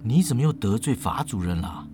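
hushed aside. Acted. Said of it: "凑到叶枫的耳边问说".

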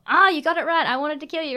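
high, loud burst of mock fury; hammed-up angry outburst; mock anger. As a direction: imitate an unhinged creature losing it. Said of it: "angry, angry".

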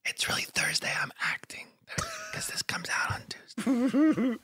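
unvoiced confiding whisper; hushed whisper to the listeners; whispered aside. Real, not acted. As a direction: let them in on the secret in a whisper. Confessing the fake day aside.

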